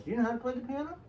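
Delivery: high-pitched mock English accent; put-on English accent, high and light; Elton's English voice. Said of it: "in his English voice".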